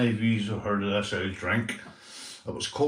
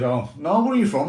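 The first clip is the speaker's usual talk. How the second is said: raised, higher challenge; imitated confrontation; 5 semitones higher, 5 dB louder than usual. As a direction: mimic a lad pressing for an answer. Confronted by boys in toilet.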